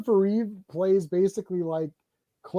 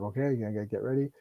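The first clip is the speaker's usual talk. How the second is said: low, soft casual murmur; understated cast chatter; mock mumbled reply; 8 semitones lower, 9 dB quieter than usual. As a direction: mumble it backstage.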